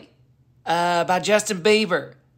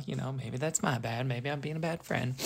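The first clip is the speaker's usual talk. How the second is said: sheepish aside; 4 semitones lower, 12 dB quieter than usual. Real, not acted. Guilty aside, much softer.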